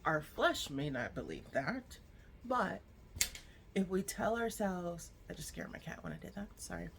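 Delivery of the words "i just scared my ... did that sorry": fast, much softer aside; hushed fast sorry; quick quiet aside. Real, not acted. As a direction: mutter an apology to the cat, quickly. Aside to the cat.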